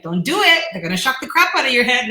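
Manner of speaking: warning clients. Retold or imitated. Coach warning clients about the shock, hammed-up loud alarm. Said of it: "so I'll share my experience and be like".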